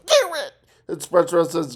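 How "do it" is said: high snapping Squidward command; Squidward order. Retold or imitated. Retelling the cartoon, Squidward orders SpongeBob high. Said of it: "Squidward says".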